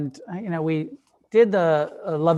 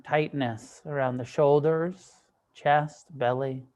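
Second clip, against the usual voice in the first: unhurried meditation guidance with long pauses; guided meditation. Acted. Teacher guiding a group to soften and relax, guided meditation. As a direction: ease them gently into stillness, leaving space between phrases.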